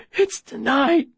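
high, hoarse remnant of a shout; strained rasp. Acted. Boy screamed out but still confessing, strained rasp. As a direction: force it out through a worn throat. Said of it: "but my voice is a rasp".

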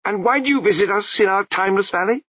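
a high babbling abbot voice, breaking into nonsense; abbot's voice. A narrator's reading. The abbot scolds the crowd, high babbling voice.

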